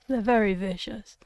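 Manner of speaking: ordinary talk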